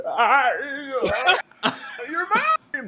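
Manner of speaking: high, bright burst of joy; excited cry. Retold or imitated. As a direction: exclaim it with delight. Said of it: "I was just like".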